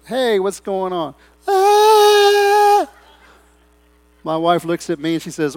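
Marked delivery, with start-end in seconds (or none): 0.1-1.1 mock cheerful greeting. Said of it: "I just went". 1.5-2.9 very high, loud yelp of fright; mock startled scream. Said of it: "I just went".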